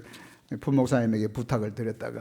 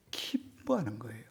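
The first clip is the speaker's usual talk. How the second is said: much softer whispered close; 10 dB quieter than usual. Real, not acted. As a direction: hush it like a secret. Preacher drops to whisper. (속삭이듯 조용하게)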